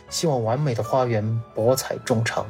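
usual speaking voice

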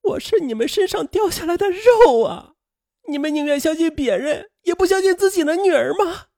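very high, loud, tearful cry; desperate screaming plea. Acted. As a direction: scream at your parents as a wronged, despairing daughter. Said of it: "声嘶力竭的喊道". (声嘶力竭地哭喊)

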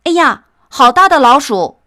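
high, loud mock shout of alarm; startled cry. Mouse mimics bystanders crying out in surprise, high and loud. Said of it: "看见的人总会叫起来说".

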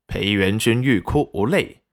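plain reading voice

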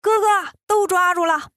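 high-pitched call to a brother; storybook triumphant holler. A narrator's reading. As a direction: call it out high, without real shouting. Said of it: "眼角就喊".

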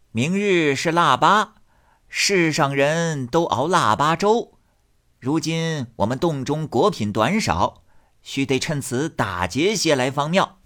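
put-on a scheming old rat chief; old rat spirit. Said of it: "老耗子生作议事，因说".

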